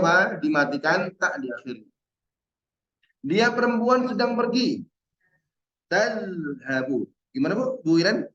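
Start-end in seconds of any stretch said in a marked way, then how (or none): 5.9-7.1 slow Tazhabu, tongue bitten on the dh sound; careful Arabic model word. Shown as a demonstration. Teacher models Tazhabu slowly, biting the tongue. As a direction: stretch out Tazhabu for students to copy. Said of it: "Zalnya digigit".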